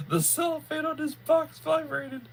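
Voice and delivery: high-pitched words squeezed out through laughter; laughing. Real, not acted. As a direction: crack up while trying to talk. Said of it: "Oh, it's a good one".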